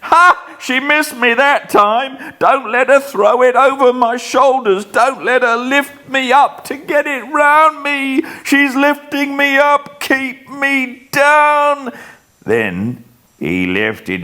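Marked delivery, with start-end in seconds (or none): 0.0-11.9 high, loud panicked shrieks; terrified screaming. Acted. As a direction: scream as the ghost comes closer. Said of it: "screams out".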